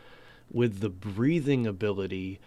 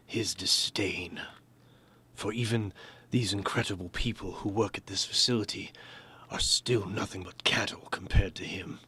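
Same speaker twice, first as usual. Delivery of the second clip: disdainful doctor. Shown as a demonstration. Voice actor demoing Doctor Griffith's disdain, whispered. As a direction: breathe scorn at the lesser people. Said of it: "you can hear his disdain".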